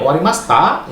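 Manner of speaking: OWARIMASHITA climbing high at the end; rising question intonation. Shown as a demonstration. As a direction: ask "Owarimashita?" with a clear upward lift. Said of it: "Ya naik".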